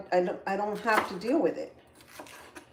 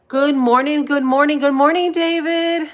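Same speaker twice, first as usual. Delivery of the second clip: higher and louder, sing-song repeated good mornings; excited greeting; 7 semitones higher, 11 dB louder than usual. Real, not acted. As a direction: greet him with big bubbly energy. Greeting viewer David again.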